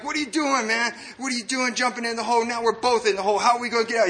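high-pitched, panicky mock protest; acted freak-out. Trapped man to the addict who jumped in, imitated panic. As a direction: play him frantic, pitch jumping up. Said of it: "freaking out".

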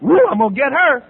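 high, loud whoop of mock triumph; vengeful glee. Recovering speaker mocking old revenge plans, gleefully. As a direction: crow it like a gleeful avenger.

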